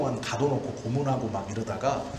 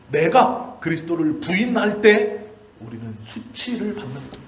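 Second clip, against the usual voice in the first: high, loud, impassioned pulpit cry, pressing each clause; preaching at full force; 7 semitones higher, 6 dB louder than usual. Acted. Pastor to his congregation on shame and glory, fervent full-force preaching. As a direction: preach it out to the back row.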